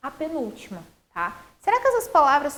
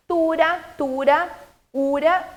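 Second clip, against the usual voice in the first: "tura, tura, ura" punched out louder, one by one; rhyme endings stressed; 3 semitones higher, 5 dB louder, slower than usual. Shown as a demonstration. Teacher showing rhyme to pupils, "tura, tura, ura" stressed and separated. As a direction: isolate "tura, tura, ura" with pauses, loudly. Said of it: "tem semelhança no ura ali no final".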